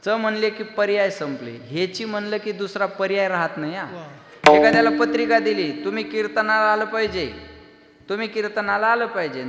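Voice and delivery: loud, ringing kirtan sermon voice; a kirtankar thundering at the gathering; full-force preaching. Acted. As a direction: preach it out to the whole crowd.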